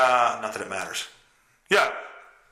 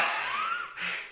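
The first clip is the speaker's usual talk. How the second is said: high squeaky laugh; 11 dB quieter than usual. Real, not acted. Laugh at own fart story.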